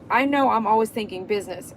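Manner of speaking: normal talking voice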